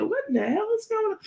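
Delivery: high, squeaky what-the-hell; shocked reaction. Retold or imitated. Reacting to Take's announcement, high shocked squeal. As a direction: squeal it out in disbelief. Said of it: "I said".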